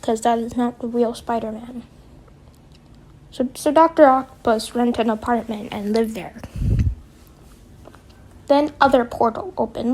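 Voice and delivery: ordinary talk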